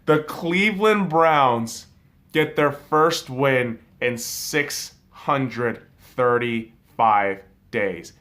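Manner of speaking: very slow, emphatic, with pauses between words; letting it sink in. Real, not acted. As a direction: spell out the news word by word. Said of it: "can we let that shit settle in".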